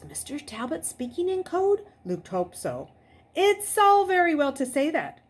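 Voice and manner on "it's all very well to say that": much higher, louder protest. A narrator's reading. Luke protests to Mister Talbot on the phone, loud. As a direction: push back hard. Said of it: "Luke protested".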